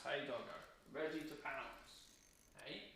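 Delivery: hushed to the dog. Real, not acted. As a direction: breathe it softly so the dog stays still. Whispering to dog.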